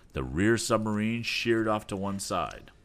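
plain reading voice

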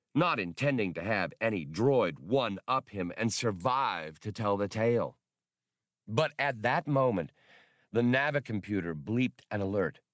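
ordinary delivery, nothing marked

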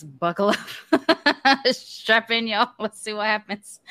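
laughing through nerves; uneasy laughter while talking; nervous laugh. Real, not acted. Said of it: "my nervous laughter thing".

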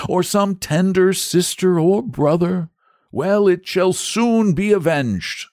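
high, earnest knightly speech; a gallant knight promising justice; noble saint voice. A narrator's reading.

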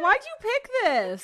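high, drawn-out wail; upset complaint. Real, not acted. Friend protesting the reader's choice of fic, upset. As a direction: plead slowly in a pained high voice.

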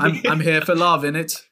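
a friend jokes in a fake British accent; mock British accent. Mocking himself in a fake British accent. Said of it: "you just walk in with your British accent".

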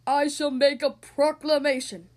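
booming, slow royal proclamation; heroic regal voice. Acted. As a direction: proclaim it like a mighty king. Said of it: "said in a heroic, regal voice".

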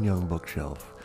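plain reading voice

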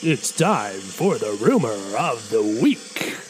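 slow, drawn-out trailer-announcer voice; John Bailey. Acted. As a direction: stretch each word like a grand announcer. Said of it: "I gotta get my John Bailey".

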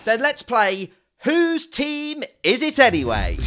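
cheesy game show host welcome; host it like a saturday night dating show; flirty teasing dating host. Acted. Launching the game.

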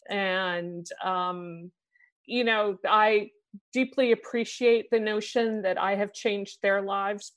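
usual speaking voice

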